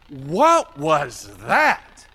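low, loud and drawn-out astonishment; astonished crowd. Acted. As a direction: stretch each word in stunned disbelief. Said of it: "Everyone was like".

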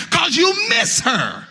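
high, ringing sermon climax with the organ behind; whooping preacher building to a cry; preaching at full force. Real, not acted. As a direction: pour it out like the peak of a funeral sermon. Funeral sermon rising to its climax.

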